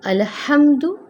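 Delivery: अल्हम्दु with the ह breathed out, rising high; breathy ha in alhamdu. Shown as a demonstration. Teacher recites alhamdu, breathed ha. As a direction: breathe the ह clearly. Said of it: "इसी तरह हम इसको पढ़ेंगे".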